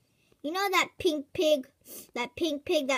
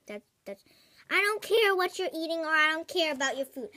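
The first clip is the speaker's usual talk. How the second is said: normal talking voice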